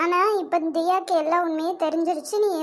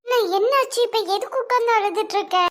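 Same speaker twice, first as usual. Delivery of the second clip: higher-pitched worried questioning; alarmed concern; 5 semitones higher than usual. Acted. Mother finding her son in tears, alarmed and higher. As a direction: press him anxiously for what happened.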